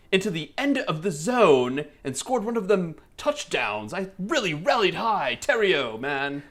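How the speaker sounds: high-pitched mock-British sports fan; swooping British accent, played for laughs. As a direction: carry on as a hammy English gent. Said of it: "So have a British person".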